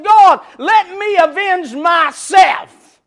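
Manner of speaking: loud, slow pleading shout; Samson's shouted vengeful prayer. Retold or imitated. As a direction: cry out to God for revenge. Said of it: "He said".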